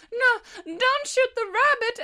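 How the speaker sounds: very high, hammed-up pleading; mock distressed plea. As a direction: beg pitifully, pitched right up. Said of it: "a brief moment of conscience".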